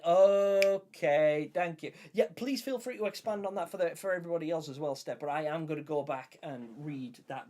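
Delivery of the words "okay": loud okay. Real, not acted. Accepting chat explanation.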